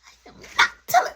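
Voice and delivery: loud, fast, voiceless burst; acted urgent refusal; adamant refusal. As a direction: refuse forcefully in a harsh rush. Refuses to tell mom.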